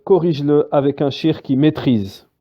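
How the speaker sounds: ordinary talk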